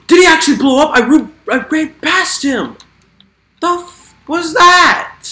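high loud gasping disbelief; shocked. Real, not acted. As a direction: react to the blast in shock. Creeper explodes near him.

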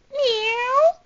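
rising, high cat meow. Acted. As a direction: mew like a small cat. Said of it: "You sound like a cat".

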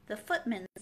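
ordinary delivery, nothing marked

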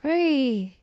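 ordinary delivery

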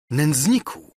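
high, indignant exclamation; outraged cry. A narrator's reading. Princess condemns Ksawery, high indignant cry. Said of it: "zawołała księżna".

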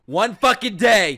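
high, loud, slow yell; exploding disbelief. Real, not acted. Viewer exploding at the twist, incredulous yell. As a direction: burst out shouting it, every word stretched. Same phrase as outburst.

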